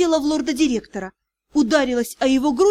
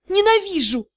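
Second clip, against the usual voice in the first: higher, tearful cry; tearful outburst; 7 semitones higher than usual. A narrator's reading. Deya to Rian, a tearful higher cry. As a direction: blurt it out through tears. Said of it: "честно призналась я".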